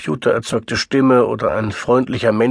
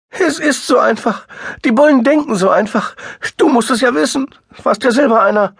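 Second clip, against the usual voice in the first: high, loud, bitter and tearful; 11 semitones higher, 4 dB louder than usual. A narrator's reading. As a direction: lash out bitterly. Sister bitter.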